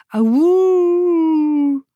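high, long drawn-out howl; wolf howl. Acted. Sings a lone wolf's howl. (huilen als een wolf)

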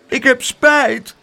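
high, loud, drawn-out little voice; child's apology. A narrator's reading. Biting child apologising to the aunt, high and slow. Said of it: "knikte Spijterbijt".

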